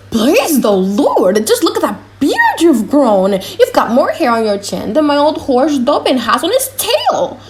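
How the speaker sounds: put-on an old man overjoyed to find his son; amazed old father. Old father marvels at son's beard.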